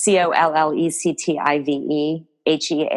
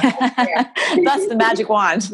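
slow, higher laughing delight; 6 semitones higher, much slower than usual. Real, not acted. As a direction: laugh through the words. Laughs at friend's warm praise.

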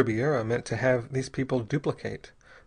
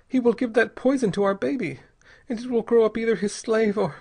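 put-on high, pleading young woman's voice; frightened young mother; 9 semitones higher, 3 dB louder than usual. A narrator's reading. Young mother pleads with Bell, high and frightened. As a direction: voice her as a terrified wife begging.

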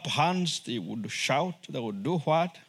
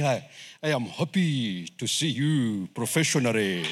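low, measured, joyless greeting; stiffly professional; 7 semitones lower than usual. Acted. Wife greeting returning husband professionally, mock-formal and cold. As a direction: say happy words with all the joy drained out. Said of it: "Can you imagine her saying".

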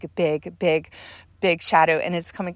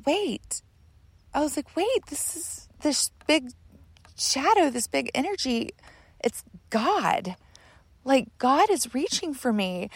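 high, hushed disbelief; awed realization; 7 semitones higher, 4 dB quieter than usual. Retold or imitated. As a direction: let it dawn on you, high and tentative. Reliving sudden realization it is God.

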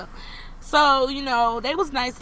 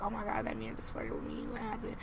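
hushed; 11 semitones lower, 13 dB quieter than usual. Retold or imitated. Retold inner thought, awkward.

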